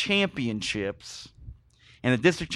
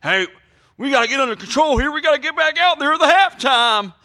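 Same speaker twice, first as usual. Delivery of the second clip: high, loud panicky coach rallying his team; imitating the coach; 11 semitones higher, 4 dB louder than usual. Coach pleading with his players at halftime, high near-shout. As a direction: squeal it out like a coach losing control. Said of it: "and then he's like".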